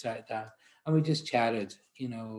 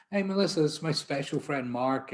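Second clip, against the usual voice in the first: imitating a loud, much higher hearty greeting; mock cheerful introduction; 5 semitones higher, 5 dB louder than usual. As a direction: boom it out like a buddy showing off his special friend. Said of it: "introduces me to everyone as his".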